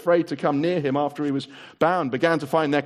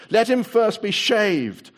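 higher, louder, slow command; witch's order; 8 semitones higher, 6 dB louder, slower than usual. A narrator's reading. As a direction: savour the humiliation slowly. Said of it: "said the witch".

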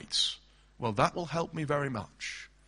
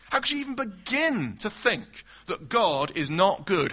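ordinary delivery

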